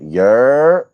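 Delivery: exaggerated Australian "Yer"; mock Australian "yer". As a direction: belt out "Yer" like an Aussie. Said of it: "an Australian would just be natural".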